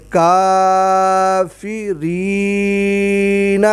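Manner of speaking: کافرین very slow, each long vowel drawn out; stretched kaafireen. Shown as a demonstration. Teacher reads the word slowly for the pupil. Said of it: "یہ دیکھیں".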